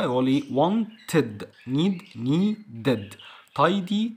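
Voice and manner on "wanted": "wanted" split as won-ted; separate "id" syllable. Shown as a demonstration. Teacher showing the ending after T, separate "id" syllable. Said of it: "لا قبلها تي بقى".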